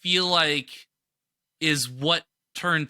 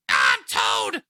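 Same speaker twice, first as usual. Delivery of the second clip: Toad; 11 semitones lower than usual. Retold or imitated. Host imitating Toad's voice from the movie, raspy character yell. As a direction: belt it out in a harsh, put-on cartoon voice. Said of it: "Very much sounds very similar to the".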